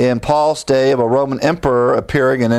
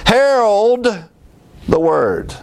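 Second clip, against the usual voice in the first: herald cry; 8 semitones higher, slower than usual. Real, not acted. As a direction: fling the word out. Preacher crying out one word.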